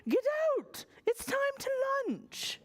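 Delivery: high, bossy queen. Acted. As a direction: order him out, high and firm. Queen speaking.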